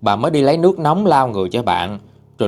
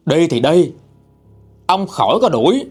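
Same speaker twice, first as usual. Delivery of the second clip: defiant son; 6 semitones higher than usual. Acted. Son defies father, slams bowl.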